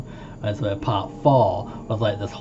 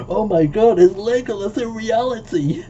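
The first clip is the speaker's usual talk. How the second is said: high, loud starstruck squeal; excited gasp; 11 semitones higher, 4 dB louder than usual. Retold or imitated. Fan spotting the Legolas lookalike guide, thrilled high squeal. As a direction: gush like a fan spotting a celebrity. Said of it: "and was just like".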